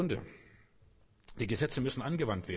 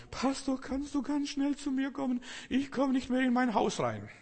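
much higher voice of a flustered woman; distressed woman caller; 13 semitones higher than usual. Retold or imitated. Church sister begs her pastor over the phone, high and flustered.

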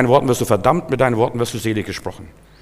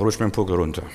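low, softer private aside; under the breath; 6 semitones lower, 7 dB quieter, faster than usual. Acted. Preacher's private retort to whoever annoys him, under the breath. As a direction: say it quietly to yourself. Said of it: "das sage ich nicht laut".

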